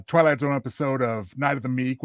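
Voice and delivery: ordinary talk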